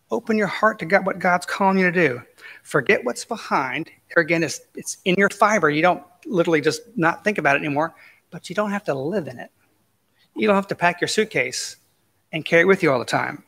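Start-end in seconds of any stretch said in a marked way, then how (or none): none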